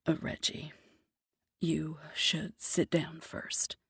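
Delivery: soft, slow, serious warning; grave voice. Acted. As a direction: prepare her for bad news. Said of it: "he says in a grave voice".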